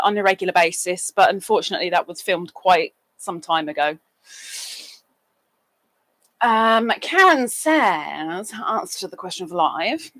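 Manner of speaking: ordinary talk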